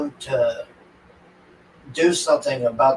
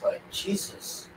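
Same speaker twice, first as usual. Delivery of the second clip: breathy aside; 7 semitones higher, 14 dB quieter, slower than usual. Real, not acted. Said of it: "reacting to brutal opening".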